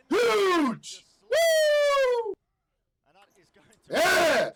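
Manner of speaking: high, loud whoops of joy; hyped victory yells; pumped-up shouts. Real, not acted. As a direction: cheer the big play at full voice. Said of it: "That was me pumped up in a good mood".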